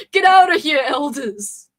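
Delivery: loud, high shout to shoo them off. Real, not acted. Streamer yelling at the game elders to leave, loud shoo. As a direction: yell them off the lake.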